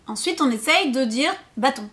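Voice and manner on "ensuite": ordinary talk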